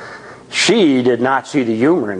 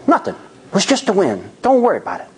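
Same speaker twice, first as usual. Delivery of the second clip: lightly voiced, higher and casual; reassuring brush-off; 6 semitones higher, faster than usual. A narrator's reading. Johnny soothing nervous Laura in the car, higher and easy. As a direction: wave it off like it's nothing.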